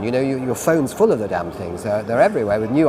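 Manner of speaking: usual speaking voice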